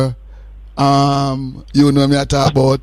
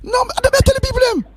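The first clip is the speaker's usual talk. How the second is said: excited cut-in; much faster than usual. Real, not acted. Cuts in to address listeners.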